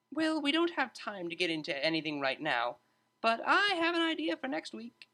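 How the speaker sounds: high, bright-toned teacher breaking up a fight; a lively tutor stepping in to calm two students; cheery tutor voice. A narrator's reading. Tutor calming arguing students, high cheerful voice.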